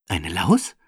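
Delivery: put-on a little boy asking, intrigued; curious child voice. Benny staunend zu Frau Kramer, kindliche Stimme. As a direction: voice Benny as a wondering kid.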